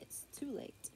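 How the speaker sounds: whispering. Real, not acted. Child just woke, whispering at night.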